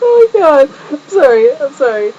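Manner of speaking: high, loud laughing outburst settling into apology; helpless giggling. Real, not acted. Vlogger apologizing to viewers at the start, giggling helplessly. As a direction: apologize through real giggles, coming down in pitch. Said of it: "I'm on laughing gas right now".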